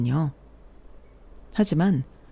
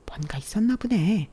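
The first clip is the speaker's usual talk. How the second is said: gossipy whisper; 5 semitones higher than usual. Acted. Coworker gossiping under breath.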